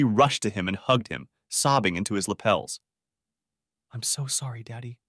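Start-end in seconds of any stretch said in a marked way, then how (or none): 3.9-4.6 much softer, sob-choked apology; sobbing. A narrator's reading. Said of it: "she sobbed". 4.6-5.0 much softer, tear-choked daddy; sobbing daddy. A narrator's reading. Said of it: "she sobbed".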